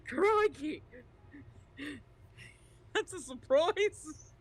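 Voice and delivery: high, slow Aussie through laughter; exaggerated Australian, cracking up; Australian accent. Real, not acted. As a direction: let a broad Aussie twang take over. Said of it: "stuck in this shitty Australian".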